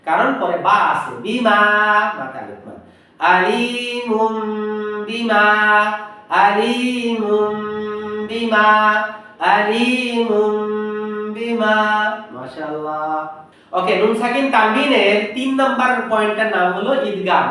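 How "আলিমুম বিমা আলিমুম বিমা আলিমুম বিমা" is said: আলিমুম chanted slowly with a long hummed final m; mun becomes mum. Shown as a demonstration. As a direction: stretch আলিমুম, then a short বিমা. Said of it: "মুন হয়ে যাবে মুম". (আলিমুম-এর শেষ ম গুন্নাসহ টেনে)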